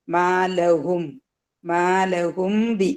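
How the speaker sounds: slow recited Arabic, drawn out; Quran recitation demo. Shown as a demonstration. Teacher reciting the phrase slowly for students. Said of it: "സാക്കിനായ ഒരു മീമുണ്ട്".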